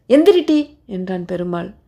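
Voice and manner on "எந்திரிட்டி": high, impatient command; rough order. A narrator's reading. Perumal orders the frightened woman up, high sharp command.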